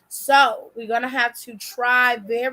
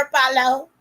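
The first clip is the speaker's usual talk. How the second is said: normal talking voice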